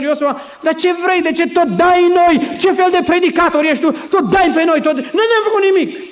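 loud, high-pitched complaint; indignant protest. Acted. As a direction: act out an aggrieved crowd pushing back. Accused Israelites protest to Joshua.

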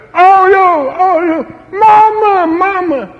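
high, loud wailing cries for mother; tortured prisoner's cry. Retold or imitated. Prisoner beaten in the next cell, wailing for his mother. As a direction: wail in pain under the blows. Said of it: "și auzea".

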